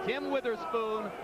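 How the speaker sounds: ordinary talk, nothing marked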